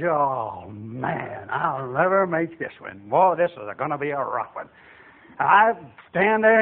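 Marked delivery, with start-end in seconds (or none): 0.0-4.7 low, very soft groaning to himself; dismayed muttering. Retold or imitated. Recruit sees his muddy hat, low dismayed mutter. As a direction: mutter in dread under your breath.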